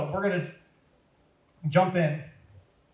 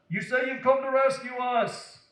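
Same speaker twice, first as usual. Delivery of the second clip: 높은 음으로 조롱하며 외치기; 군중처럼 비웃으며 소리쳐; mock shout; 9 semitones higher than usual. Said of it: "the people shouted".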